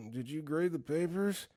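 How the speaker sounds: soft, drowsy sleep-talk question; sleepy grumble. Retold or imitated. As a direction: grumble it softly as if half asleep. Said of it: "Sleepy grumble".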